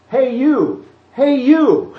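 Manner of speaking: put-on a small kid boldly calling to a stranger; little kid calling out. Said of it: "walking up to a man and going".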